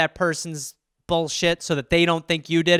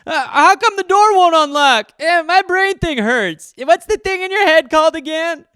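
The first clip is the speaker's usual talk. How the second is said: put-on higher, louder, dopey child voice; dim kid voice; 10 semitones higher, 7 dB louder than usual. Dopey kid whining to his mom, hammed up. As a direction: ham up the confused kid. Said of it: "And I was like".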